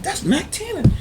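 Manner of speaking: high, urgent recognition; spotting a rival. Retold or imitated. Spotting rivals in Atlanta, urgent high voice. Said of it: "I'm like".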